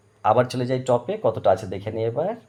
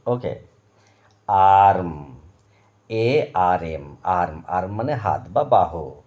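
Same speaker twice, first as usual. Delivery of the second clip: normal talking voice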